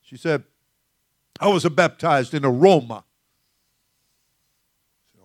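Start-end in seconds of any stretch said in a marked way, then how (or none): 1.4-3.0 put-on Jamaican accent. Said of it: "Got that accent going on there".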